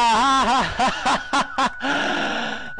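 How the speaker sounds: loud burst of laughter; real loud laughter. Real, not acted. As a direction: laugh out after the fright. Bursts laughing after panic.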